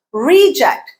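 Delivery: "Reject" as REE-ject, first syllable high; stress on first syllable. Shown as a demonstration. Teacher showing the noun form, first-syllable stress. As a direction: punch a long first vowel in "Reject". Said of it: "you change the stress pattern and the vowel".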